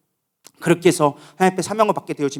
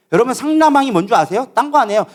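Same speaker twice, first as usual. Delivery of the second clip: usual speaking voice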